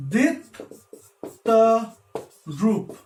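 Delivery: DIP, TA, ROOP each said alone with pauses; split into syllables. Shown as a demonstration. Teacher showing syllables in own name, syllable by syllable. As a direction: break "Dip Ta Roop" into three separate beats. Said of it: "which you can pronounce in one go".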